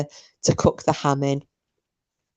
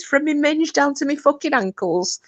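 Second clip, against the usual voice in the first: put-on much higher, amateur Irish accent; attempted Irish accent; 10 semitones higher than usual. Pregnant daughter answers her Irish mammy, attempted Irish accent. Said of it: "somebody who could do an Irish accent".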